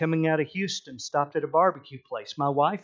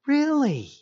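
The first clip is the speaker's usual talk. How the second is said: mock surprise; 10 semitones higher than usual. Husband surprised.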